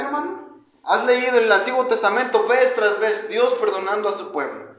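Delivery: ordinary talk, nothing marked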